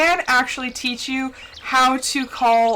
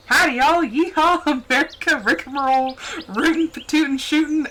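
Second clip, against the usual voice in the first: hammed-up Southern cowboy; 4 semitones higher than usual. Streamer shows viewers a hammed-up Southern cowboy. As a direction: ham up the Texas cowboy for fun. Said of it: "I'm just showing everyone".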